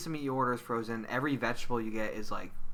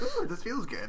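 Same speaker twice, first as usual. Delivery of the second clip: high giddy sugar-rush voice, pitched way up; giddy kid voice; 10 semitones higher than usual. Retold or imitated. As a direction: coo it like a happy kid.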